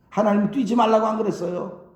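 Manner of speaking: ordinary talk, nothing marked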